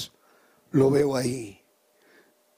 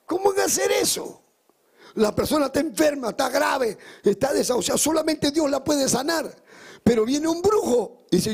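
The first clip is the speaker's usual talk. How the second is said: fiery preaching; 7 semitones higher than usual. Acted. Pastor urging his congregation to let Jesus in, preaching at full force. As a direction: preach it hard and high, pushing every phrase up.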